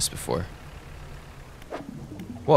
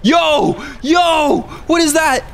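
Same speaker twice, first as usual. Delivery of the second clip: panicked screaming, much higher and louder; scared horror game streamer; 8 dB louder, much slower than usual. Real, not acted. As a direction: yell in real fright at the monster. Chased by giant serpent.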